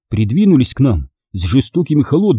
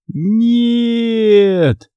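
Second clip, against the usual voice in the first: long, high cry of despair; hoarse wail; 10 semitones higher than usual. A narrator's reading. Boy watching the card fly away, wailing. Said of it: "завопил я хриплым голосом".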